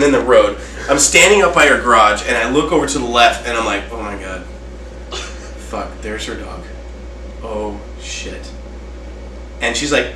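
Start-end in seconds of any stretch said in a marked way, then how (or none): 3.9-8.5 hushed, much softer, half-whispered curses; stunned hush. Retold or imitated. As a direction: barely breathe the words out in shock. Frozen, seeing the dead dog.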